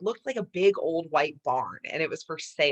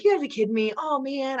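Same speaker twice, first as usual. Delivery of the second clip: exasperated disbelief; 9 semitones higher than usual. Retold or imitated. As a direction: throw your hands up at it. Exasperated at hearing the news.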